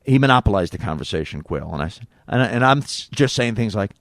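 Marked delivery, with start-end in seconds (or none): none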